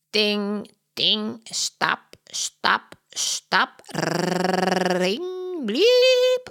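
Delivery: squawky parrot voice, ending on a very high bliep; a parrot copying the hotel noises; parrot mimicking sounds. Polly the parrot squawking hotel sounds at the kids. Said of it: "zegt Polly".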